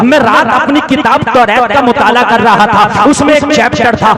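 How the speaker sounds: loud climactic sermon storytelling; crowd-rousing preacher's telling; full-force preaching. Acted. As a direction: drive the story home loudly.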